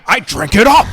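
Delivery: high, roaring movie-villain yell; Daniel Plainview shout. Retold or imitated. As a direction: bellow it like Plainview gloating. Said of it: "There Will Be Blood".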